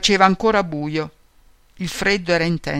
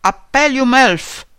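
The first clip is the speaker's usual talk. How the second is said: slow, raised narrated shout; higher, louder, stretched-out announcement; shouted roll call; 6 semitones higher, 6 dB louder, slower than usual. A narrator's reading. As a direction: call it out loud and drawn out, like a kapo at roll call. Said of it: "un capò che gridava".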